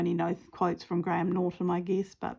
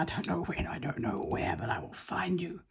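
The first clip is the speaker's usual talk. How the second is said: Liam Neeson; 2 semitones lower, 8 dB quieter than usual. Acted. Crafter playing the famous movie star's quote, whispered threat. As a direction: whisper it as a hushed, menacing film quote. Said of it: "I feel like I'm, um, that famous movie star now".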